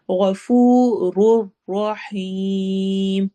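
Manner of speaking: غَفُورٌ رَحِيمٌ with a heavy غ, long فو and longer ریم at the stop; thick غ and stretched vowels, slowly; heavy ghain, long madd. Shown as a demonstration. Teacher reciting غَفُورٌ رَحِيمٌ in tajweed, slow. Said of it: "غائن heavy ہے، موٹا ہے".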